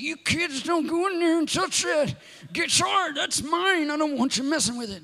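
high, hammed-up grandpa yelling at the kids. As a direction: bark it out like a cranky old grandpa guarding his guitar. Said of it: "he actually kinda talked like this".

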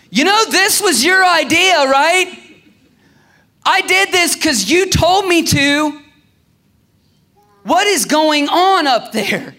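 high, loud, drawn-out shouting to heaven; exasperated prayer. Acted. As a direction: yell up at God in disbelief, stretching the words. Acted exasperated prayer yelled up at God.

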